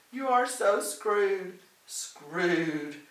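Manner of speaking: loud, drawn-out gloating threat; ranting. A narrator's reading. Helen rants at Lorraine after a swig, gloating. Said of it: "her ranting house guest".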